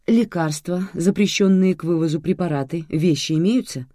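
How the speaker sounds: stern airport officer; strict. A narrator's reading. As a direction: firmly check off the forbidden items. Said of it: "строго уточнила сотрудница".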